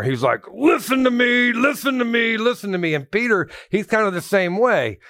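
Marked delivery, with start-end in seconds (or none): 0.5-2.9 high, shouted listen to me, easing off on the last one; pounding shout. Retold or imitated. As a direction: hammer it home like a preacher. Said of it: "Jude is like a sledgehammer".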